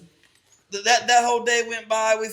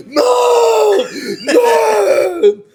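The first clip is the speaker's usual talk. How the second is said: high, loud wailing cry to his mom; retold crying plea; 13 semitones higher, 13 dB louder, much slower than usual. Kid running downstairs to mom, crying out in panic. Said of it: "I'm fucking crying".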